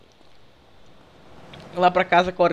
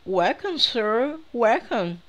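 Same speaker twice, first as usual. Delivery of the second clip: higher-pitched mock female game voice; imitando voz feminina; 6 semitones higher, much slower than usual. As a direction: parrot the woman's greeting in a raised voice. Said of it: "Voz da mulher". (imitando a voz da mulher do jogo)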